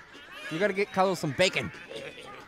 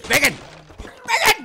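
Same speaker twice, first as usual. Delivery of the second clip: high, loud yelled "bacon"; silly shouted "bacon"; 12 semitones higher, 10 dB louder than usual. Pretend. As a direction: belt out "bacon" like a battle cry. Yelling bacon as game cry.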